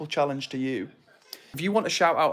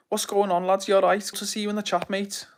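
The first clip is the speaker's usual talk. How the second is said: light Scouse greeting, pitched higher; mock Liverpool accent; 6 semitones higher than usual. Greeting a chatter from Liverpool in a playful Scouse accent. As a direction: put on a bit of a Liverpool accent for a Liverpool viewer. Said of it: "Probably not even got an accent like that".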